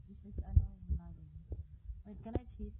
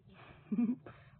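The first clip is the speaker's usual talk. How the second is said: amused laugh; 2 semitones higher, 3 dB louder than usual. Real, not acted. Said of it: "that's so funny".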